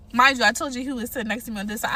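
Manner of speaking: ordinary talk, nothing marked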